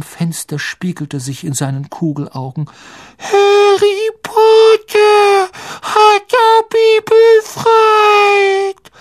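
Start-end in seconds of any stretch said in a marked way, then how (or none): 3.2-8.9 very high, loud, drawn-out elf voice; schriller Hauself. A narrator's reading. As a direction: squeak it slowly in awe. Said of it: "sagte der Elf schrill". (schrill und ehrfürchtig gedehnt)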